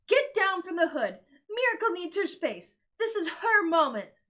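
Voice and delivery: high, loud scolding; mother yelling at Tukey. Acted. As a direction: order her down sharply.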